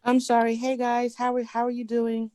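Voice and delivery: ordinary talk, nothing marked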